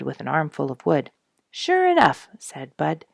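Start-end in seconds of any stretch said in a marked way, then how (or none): 1.5-2.3 excited agreement. Acted. Bud answers Mary excitedly.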